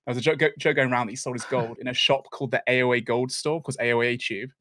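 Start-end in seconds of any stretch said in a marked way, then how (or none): none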